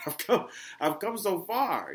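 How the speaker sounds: loud, high, slow amazement; amazed exclamation. Retold or imitated. Podcaster laughing at how far he has come, loud amazement. Repeats the quote after laughing.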